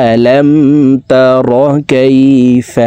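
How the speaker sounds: recited word by word with drawn-out vowels; measured tajweed teaching recitation. Shown as a demonstration. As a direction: chant each word separately for learners to repeat. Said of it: "শব্দে শব্দে ভেঙে ভেঙে বলে দিচ্ছি".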